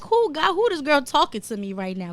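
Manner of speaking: high, loud astonished cry; a sudden loud shocked "who is this"; shocked disbelief. Retold or imitated. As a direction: burst out amazed at yourself. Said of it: "I'm like".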